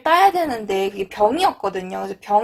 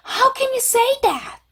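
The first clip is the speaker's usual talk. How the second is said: very high, stretched-out hurt protest; 감정이 상한 듯; 13 semitones higher, much slower than usual. Shown as a demonstration. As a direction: plead in disbelief, slow and pitched way up. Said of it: "여러분 막 감정적으로 상했을 때". (상처받아 아주 높게 늘여 따지기)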